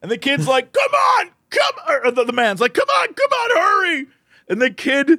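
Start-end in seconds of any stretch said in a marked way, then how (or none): 0.7-1.7 very high, strained mock holler; urgent urging. Man urges the kid with keys, imitated holler. 2.7-4.1 very high, strained mock holler; urgent urging. Man urges the kid with keys, imitated holler.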